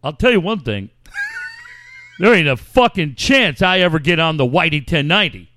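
a loud, fast impression of a friend's radio voice; imitating C S's voice. Friend on the phone, upset about the station, in his big voice. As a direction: do your buddy's booming line. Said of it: "in that voice, man, he had the best voice".

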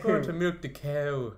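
slow hammed-up Irish accent on "cow"; slow mock Irish. Trying an Irish accent for friends, slow mock Irish. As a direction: drag out "Going to milk the cow" like a joking Irish farmer. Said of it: "do some Irish accent".

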